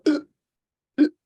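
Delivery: short imitated choking grunts; choking gasp. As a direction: act out failing to draw breath. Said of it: "but I could not breathe in".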